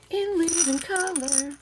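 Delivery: high, slow show-title call; TV show announcer. Retold or imitated. Recalling a favourite show with a mock announcer call. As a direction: announce it like the old TV intro. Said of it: "that was my show back in the day".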